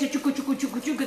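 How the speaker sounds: soft mouth-noise chuku of a food chopper; imitated chopper noise. Cook mimics her chopper for viewers, soft chuku noise. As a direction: quietly mimic the chopper whirring. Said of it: "that does the cuchu".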